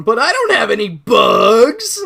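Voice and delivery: slow, loud indignant whine; mock Eric. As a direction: drag it out loud and offended. Voicing Eric, confused.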